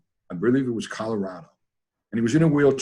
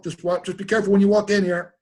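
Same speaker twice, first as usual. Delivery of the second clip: imitating Richard calling out higher; Richard's warning; 9 semitones higher, 3 dB louder than usual. Richard warning his friend at the door, high mock call. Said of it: "Richard goes".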